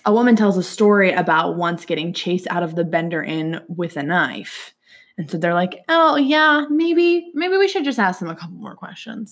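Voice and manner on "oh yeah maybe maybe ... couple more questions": mock innocent. Mock-innocent suspicious travellers.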